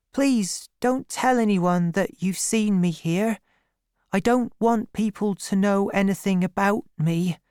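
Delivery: put-on high, shy girl's plea; secretive young girl, pleading. A narrator's reading.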